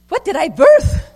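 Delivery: put-on overwhelmed mom shouting at her screaming baby; mock horrified shout. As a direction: yell it like a desperate new mother. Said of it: "she's going".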